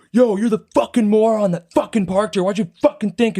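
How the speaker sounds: high, heated rant as the angry driver; acted anger. Angry driver to the man who parked there, acted anger. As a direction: imitate a stranger laying into you. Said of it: "he went from super angry".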